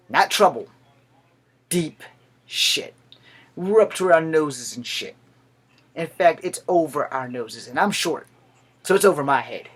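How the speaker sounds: loud, punched-out warning; emphatic. Real, not acted. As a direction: raise your voice and spell it out. Emphatic warning about the country.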